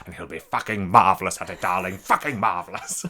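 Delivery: hammed-up sneering darling; theatrical Peter O'Toole. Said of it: "And he goes".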